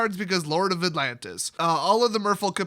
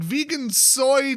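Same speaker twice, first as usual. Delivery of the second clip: ordinary talk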